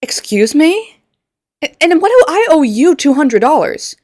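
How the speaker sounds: high, loud, slow outraged "excuse me"; indignant victim. Acted. Insulted man demands why he owes her money, outraged. Acted outraged reply in skit.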